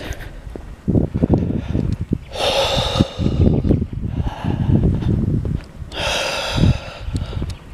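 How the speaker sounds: slow deep breathing in and out; long calming breaths. Real, not acted. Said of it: "try taking a few deep breaths".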